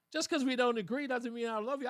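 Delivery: usual speaking voice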